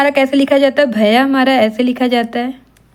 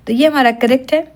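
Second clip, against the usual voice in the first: normal talking voice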